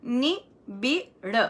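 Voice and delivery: "निबिड़" slowly, ni-bid with clear i sounds; careful slow model; slow correct form. Shown as a demonstration. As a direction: draw out "निबिड़" syllable by syllable. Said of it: "निबिड़ है".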